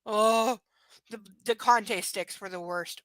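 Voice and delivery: ordinary talk, nothing marked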